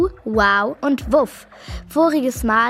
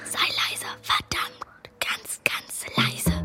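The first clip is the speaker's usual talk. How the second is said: whispering; 8 dB quieter than usual. Acted. Kids hiding from burglars.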